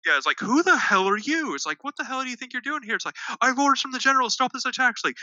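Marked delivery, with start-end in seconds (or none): 0.4-1.5 high, mock-indignant demand; indignant challenge. Commander to a man breaking into his tent, indignant challenge. 1.8-3.0 high, quick, softened mock outrage; indignant challenge. Commander scolding the intruder in his tent, mock indignation. 3.4-4.9 high-pitched acted urgency; urgent plea. Messenger to commander with orders from the general, urgent plea.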